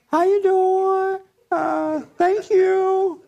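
very high, slow falsetto greeting; hammed-up high sweet clerk voice. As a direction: put on a dainty customer-service voice.

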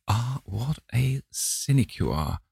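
a lightly voiced old lady crying out. A narrator's reading. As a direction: tease him as a fond grande dame. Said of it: "cried the old lady".

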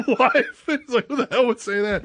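can barely get it out for laughing; laughing retelling. Retold or imitated. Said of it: "he laughs says".

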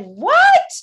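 put-on a mom bewildered by her child's claim; shocked what. Mom hears daughter call the frog mad: imitating her astonished, high, loud reply.

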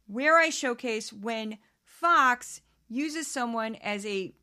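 usual speaking voice

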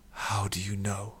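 slow, whispered question; very quiet. A narrator's reading. Defeated addict questions the old man, very quietly. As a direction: whisper it slowly, barely voiced. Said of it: "very quietly".

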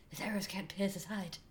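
soft breathy whisper. Acted. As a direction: breathe the words like a sultry elf. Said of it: "I'm going to talk in a breathy voice".

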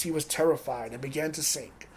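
ordinary talk

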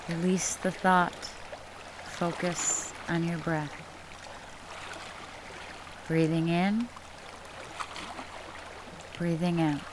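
guided meditation. Acted. Leads guided breathing meditation.

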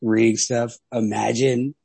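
ordinary delivery

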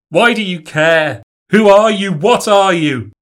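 loud, high desperate questions; desperate shouting. A narrator's reading. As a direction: demand answers at full voice. Narrator shouting at the girl.

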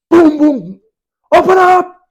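high, loud angry shout; furious pounding demand. Retold or imitated. Umar at his sister's door, shouting furiously to be let in. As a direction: bang and bellow at the door. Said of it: "He's furious".

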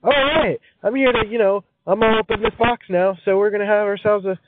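high, peppy party greeter voice; excited party host. Pretend. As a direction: greet the crowd like an eager host.

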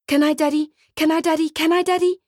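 put-on a boy pestering his dad; begging child.

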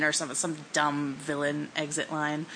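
ordinary delivery

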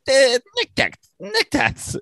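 squeaky, very high mimicry of a fussing old man; mocking old man. Co-host mimicking Andy's neck-tat complaint, mocking old-man voice. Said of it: "old man handing away of the neck tats that you just did. You're like".

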